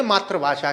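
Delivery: "मातृभाषा" as maatra-bhaashaa, tra not tri; common mispronunciation. Shown as a demonstration. Teacher quoting what many people answer, "मातृभाषा" as maatra-bhasha. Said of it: "तो वो बोलेंगे मातृभाषा".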